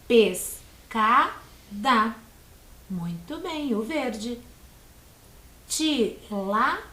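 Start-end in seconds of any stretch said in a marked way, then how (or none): 0.1-2.2 Pescada split as PES-CA-DA, very slow; syllable by syllable. Shown as a demonstration. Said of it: "Três sílabas". 5.7-6.8 Tilápia split as TI-LÁ-PIA, slowly; syllable by syllable. Shown as a demonstration. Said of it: "Também três sílabas".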